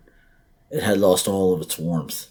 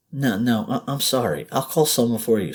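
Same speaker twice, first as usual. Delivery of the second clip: flustered apology; 6 semitones higher than usual. Acted. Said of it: "Sandra said".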